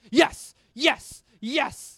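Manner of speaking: put-on high, very loud drunken yells; drunk heckler shouting. Comic imitating the drunk woman heckling, shouted. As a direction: yell each yes like a rowdy heckler.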